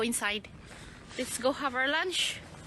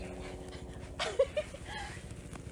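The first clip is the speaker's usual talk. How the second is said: high giggle; 7 dB quieter than usual. Real, not acted. Toddler playing in the snow.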